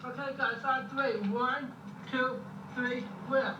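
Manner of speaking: high called-out count; lifting together. Acted. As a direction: count the crew in to lift. Crew counting to lift patient.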